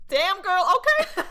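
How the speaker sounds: high, loud, giddy cheer; playfully over-the-top admiring reaction; impressed whoop. Retold or imitated. Re-enacting own impressed, giddy reaction.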